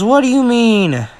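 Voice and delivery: high, slow, coy "what do you mean?"; mock innocent. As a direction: play dumb with a sweet lilt. Mina feigning innocence to Jiro.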